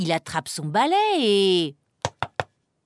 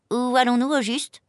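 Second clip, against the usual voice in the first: slightly higher, a touch anxious; mildly uneasy question; worried; 4 semitones higher, 3 dB louder than usual. Acted. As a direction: ask it like a fretting passenger. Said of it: "s'inquiète tout de même".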